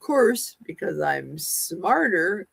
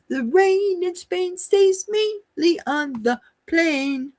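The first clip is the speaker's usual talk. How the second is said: high, slow put-on British accent; mock British accent; 11 semitones higher than usual. Shown as a demonstration. Elocution drill in a mock British accent. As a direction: recite it in a hammed-up British accent. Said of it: "Do I need a British accent?".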